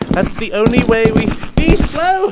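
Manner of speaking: rallying shout. Acted. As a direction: rouse the crowd like a zealot. Rallying cry to be slow.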